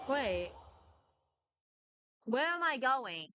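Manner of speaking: ordinary talk, nothing marked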